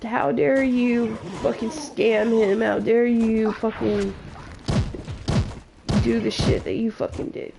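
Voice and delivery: hurt, bitter anger at the other woman; real anger. Real, not acted. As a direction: let the hurt turn to anger on how dare you. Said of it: "I'm angry".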